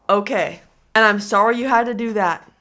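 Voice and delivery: ordinary talk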